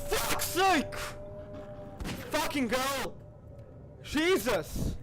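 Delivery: loud, high-pitched cursing; high, jolted outburst; startled shouting. Real, not acted. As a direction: shout in real shock at a jump scare. Startled by game scare.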